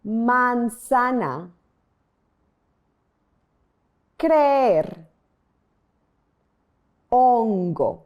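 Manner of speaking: "Manzana, creer, hongo" said slowly, each vowel crisp and unchanged; careful Spanish vowel demo of "Manzana, creer, hongo"; Spanish pure vowels. Shown as a demonstration. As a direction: give every vowel in "Manzana", "creer", "hongo" one clean Spanish sound. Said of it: "Pay close attention to the pronunciation".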